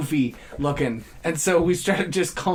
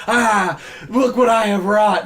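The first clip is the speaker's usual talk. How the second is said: mock grandiose; 3 semitones higher, 4 dB louder than usual. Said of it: "He's like".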